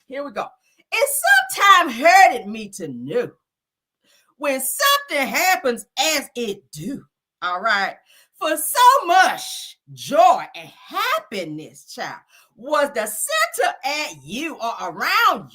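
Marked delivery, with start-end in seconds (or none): 0.9-7.0 slow, drawn-out spoken-word delivery; mock-solemn slam poetry reading; dramatic poem reading. 8.4-15.5 very slow, lingering poet voice; hammed-up spoken-word recital; dramatic poem reading.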